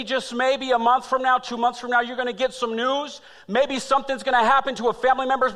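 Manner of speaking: high, loud, driving preaching; preaching at full force. Acted. Pastor telling his family's crisis call, loud preaching. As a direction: build the sermon to a peak.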